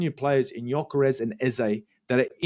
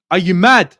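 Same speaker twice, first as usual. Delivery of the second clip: loud, higher, incredulous; exasperated outburst; 4 semitones higher, 11 dB louder than usual. Real, not acted. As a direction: shout it in disbelief. Exasperated outburst at fan comment.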